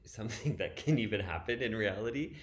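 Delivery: real laugh breaking into explanation; amused, through a laugh. Real, not acted. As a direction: let a chuckle lift the words.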